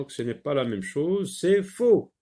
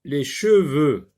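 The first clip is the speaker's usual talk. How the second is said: cheveux said slowly and louder, with a clear E sound; slow careful reading; 2 semitones higher, 6 dB louder than usual. Shown as a demonstration. Teacher spelling out cheveux for the pupil. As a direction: stretch out cheveux so the child hears the E. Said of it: "je ne coche pas parce que j'entends".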